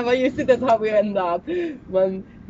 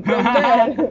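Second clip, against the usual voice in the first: normal talking voice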